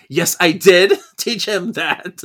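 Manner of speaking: loud, slow, hammed-up protest; defensive retort. [name]'s defensive retort, acted.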